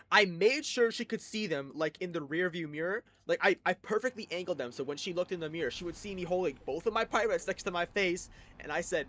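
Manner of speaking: ordinary delivery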